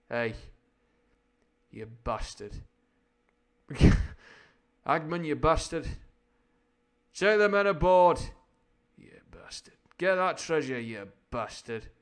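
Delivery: rough attempt at a gruff actor impression; hammed-up swearing, swinging from shouted orders to muttered curses; Sean Bean. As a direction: lightly imitate a film star cursing his crew. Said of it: "I can't really do Sean Bean".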